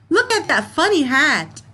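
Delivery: excited exclamation. Shown as a demonstration. Teacher reading a funny-hat sentence to her class, excited. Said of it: "you laugh or you make a comment".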